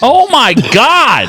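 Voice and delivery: high, drawn-out horrified exclamation; slow, high-pitched disbelief; real shock. Real, not acted. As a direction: stretch it out, appalled at what you see. Shocked reaction to gruesome video.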